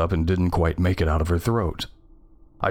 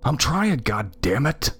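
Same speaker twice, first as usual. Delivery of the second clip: higher, exasperated retort; tense, irritated hiss with voice; hissed in anger; 7 semitones higher, slower than usual. A narrator's reading. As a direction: snap back under pressure, pitched up. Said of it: "I hissed".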